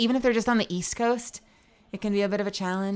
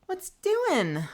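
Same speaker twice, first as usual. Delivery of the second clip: normal talking voice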